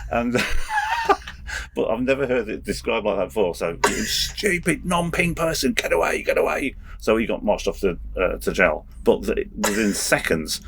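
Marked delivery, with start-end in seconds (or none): 3.9-6.7 shouting drill sergeant. Retold or imitated. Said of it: "the drill sergeant shouted at him".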